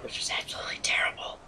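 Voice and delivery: quick whispered aside. Real, not acted. Aside about a terrible teammate.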